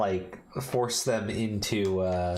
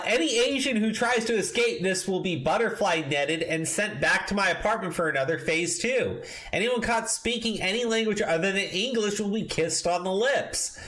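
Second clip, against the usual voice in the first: high, raised reading voice; mock pompous reading; 9 semitones higher than usual. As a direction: read it like a smug manifesto. Reads article quotes in a put-on voice.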